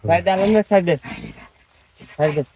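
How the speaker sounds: loud urgent shouts; shouting. Real, not acted. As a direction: yell to clear the way. Urgent shouting during struggle.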